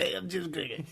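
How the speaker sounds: hammed-up teasing copy of his co-host; James Craigie. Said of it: "That's my impression".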